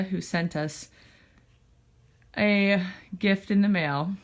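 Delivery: normal talking voice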